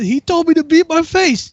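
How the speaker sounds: high, loud mock plea; flustered protest. Recruit tells on the sergeant, mock panic. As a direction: blurt it out like a panicked private.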